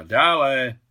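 explosive, impatient holler; exasperated shout. A narrator's reading. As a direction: yell impatiently toward the door. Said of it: "vybuchla Alexandra".

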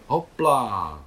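loud, bright call while leaping up; spring onto the horse with a shout; cheerful hopla. A narrator's reading. Nicole mounting her horse in the sea, loud cheerful call. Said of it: "hbitě vyletěla Nicole".

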